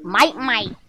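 low and soft, a quiet grumbly toy. Pretend. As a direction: mutter it low like a grumpy doll. Kid voicing toys, low croak.

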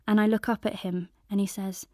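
usual speaking voice

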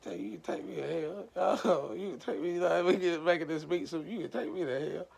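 high, loud laughing rant at God; cussing back. Retold or imitated. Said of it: "I went to cuss a guy right back out".

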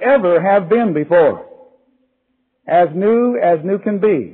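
sermon delivery, slow on the key lines; earnest preaching with slow emphasis. Acted. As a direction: preach it to the congregation, building.

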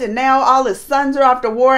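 ordinary delivery

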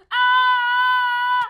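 high, very loud sustained "aah"; long scream. Real, not acted. Gamer charging into a fight, long scream. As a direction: scream it out on one held note.

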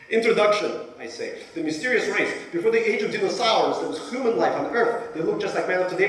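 high, loud, eager recital; eager boy reading aloud. Retold or imitated. Boy reading his novel to the class, high and eager. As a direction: read it out like a proud eleven-year-old.

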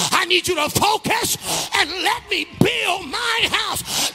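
God's reply voiced as rising, shouted sermon; full-force preaching. Preacher relaying God's answer about sent sons, climbing to a shout. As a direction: build phrase by phrase to a long high shout.

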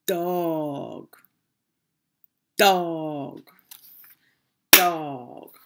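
"Dog" drawn out as one single beat; one-beat syllable demo. Shown as a demonstration. Teacher clapping a word for children, one stretched beat. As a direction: say "Dog" long and firm, falling, on one clap.

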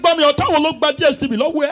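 high loud fast preacher command; shouting Yoruba pastor. Retold or imitated. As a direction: bark it like a fiery pastor.

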